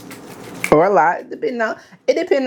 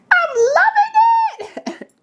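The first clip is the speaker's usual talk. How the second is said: very high, drawn-out squeal; gleeful squeal; much slower than usual. Pretend. Delighted squeal over her food.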